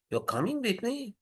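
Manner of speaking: "You're coming with me" lifted high and soft into a question; interrogative intonation. Shown as a demonstration. Lecturer puts question intonation on a statement, interrogative. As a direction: turn "You're coming with me" into a question by pitch alone. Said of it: "interrogative intonation to it".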